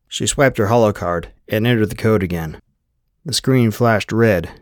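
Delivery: plain reading voice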